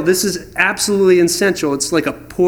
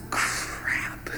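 breathless whispered crap under the breath; whispered curse; 14 dB quieter than usual. Retold or imitated. Spotting the forgotten rebar cage, a whispered crap. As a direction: hush it like a sinking realization. Realizing rebar was left out.

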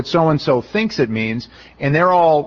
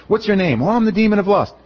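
higher, hammed-up interrogation of a demon; mock exorcist dialogue; 7 semitones higher than usual. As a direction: play both sides sarcastically. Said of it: "Why would".

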